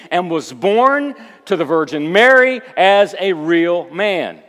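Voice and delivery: emphatic pulpit delivery; forceful preaching. Acted. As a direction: proclaim doctrine with punched key words.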